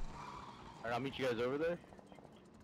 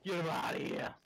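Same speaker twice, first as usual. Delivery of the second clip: mock shout; 7 semitones higher than usual. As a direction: bellow it out jokingly. Repeats louder, mocking.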